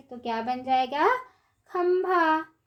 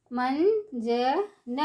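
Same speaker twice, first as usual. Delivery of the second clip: मंजन stretched slowly, syllable by syllable; slow syllable drill; much slower than usual. Shown as a demonstration. Teacher drawing out मंजन slowly for children. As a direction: draw out मंजन for the class. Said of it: "ऊंची आवाज़ में कैसे पढ़ेंगे".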